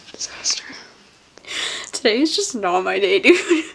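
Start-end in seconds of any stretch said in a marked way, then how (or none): 0.1-0.6 breathed out in defeat; under-the-breath disaster; whispered. Real, not acted. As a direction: whisper the word like giving up. Muttered after "Freaking.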